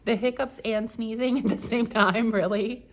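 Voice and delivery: laughing. Retold or imitated. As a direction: laugh through it. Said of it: "She's like".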